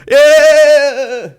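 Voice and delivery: very high, loud, drawn-out whoop; sustained mock crowd scream; imitating drunken yell. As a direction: screech it out long like a rowdy heckler.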